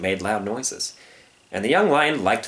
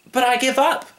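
very high, innocent protest; small-voiced cub giving up; pleading young lion; 4 dB louder than usual. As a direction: surrender earnestly.

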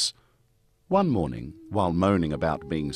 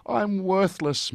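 much higher, slow self-pitying moan; 11 semitones higher than usual. Acted. Stans moaning to the Virgin about herself, higher slow whine. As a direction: moan it like a sulky brat. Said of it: "moaned Stans".

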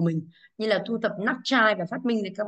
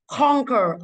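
CONquer, drawn out with weight on CON; stress on first syllable; 3 semitones higher, 5 dB louder than usual. Shown as a demonstration. Teacher showing the class word stress, CON stressed in Conquer. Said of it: "âm một và từ con".

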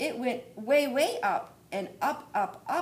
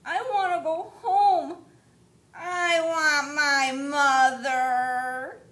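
slow, high, whimpering plea; a small chick near tears; crying baby bird; 7 semitones higher, 11 dB louder, much slower than usual. Acted. Baby bird begs to go home, tearful. Lost chick wants mother.

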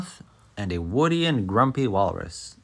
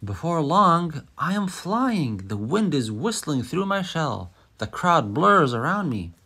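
excited; 6 semitones higher than usual. Acted. Tortoise racing, feels like flying.

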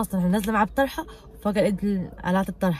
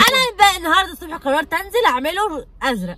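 loud protest; 9 semitones higher, 6 dB louder than usual. Acted. Prank quarrel with husband.

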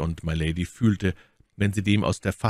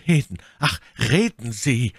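put-on urgent, louder young officer's plea; calling out; 5 semitones higher, 4 dB louder than usual. A narrator's reading. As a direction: Felton urges her to speak, raising his voice. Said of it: "rief der junge Offizier". (Felton drängt sie laut zu reden)

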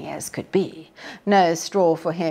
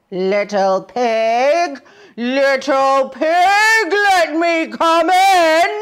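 slow, loud coaxing wolf call; 8 semitones higher, 8 dB louder, much slower than usual. A narrator's reading. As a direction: draw out the call at the door. Wolf calls at door.

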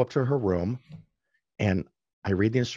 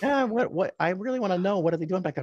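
lightly voiced wife, higher and pressing; insistent wife; 11 semitones higher than usual. Wife in the passenger seat pressing for an answer, lightly voiced higher. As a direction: mildly imitate the wife pushing for an answer. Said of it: "She goes".

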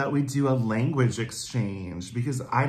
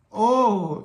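high, loud mock-German oh; a hammed-up German exclamation, pitched high; 9 semitones higher, 6 dB louder than usual. Shown as a demonstration. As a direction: let out a theatrical German oh. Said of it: "like, the German, oh".

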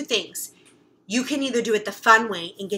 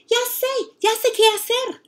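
high, eager Spanish outburst; girl cries out that she knows what to do; excited child; 12 semitones higher, 3 dB louder than usual. Acted. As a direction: blurt out the idea, thrilled. Child bursts out with her idea.

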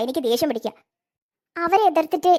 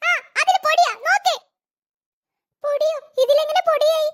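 put-on high kid voice, insistent; a little girl pointing it out; cartoon child voice; 11 semitones higher than usual. Child shows the dusty uniform.

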